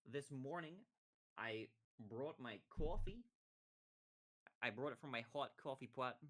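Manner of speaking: hammed-up American accent; mock American accent. Shown as a demonstration. Streamer testing an American accent on chat, hammed-up American. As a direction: lean on the American vowels in coffee. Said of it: "I sound American for seven days".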